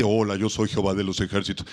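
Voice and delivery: low, booming divine voice; voice of God. Retold or imitated. Pastor imitating God appearing to speak aloud, low and grand. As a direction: announce yourself as the Lord, deep. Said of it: "materializarse y decirte".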